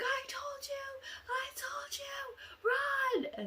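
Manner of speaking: very high, strained thin shriek; acted scream, drawn out in panic; panicked scream. Girl telling the others to run, drawn-out panicked shriek.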